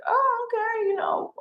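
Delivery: very high mock "oh, okay"; awkward high reply. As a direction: play yourself, politely uncomfortable. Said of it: "And I was like".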